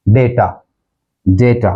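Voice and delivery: डेटा as DAY-taa; डेटा repeated with "day", not "daa"; correct pronunciation. Shown as a demonstration. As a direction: say डेटा with an "ay" first vowel. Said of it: "correct pronunciation है".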